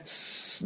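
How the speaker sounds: "ス" as a bare hiss with no vowel; air-leak S sound. Shown as a demonstration. Teacher explaining the C sound, "ス" as a voiceless hiss. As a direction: hiss "ス" like air escaping a tyre. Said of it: "タイヤのチューブで空気が抜ける音".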